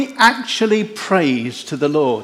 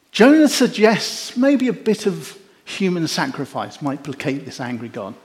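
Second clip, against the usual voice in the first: ordinary delivery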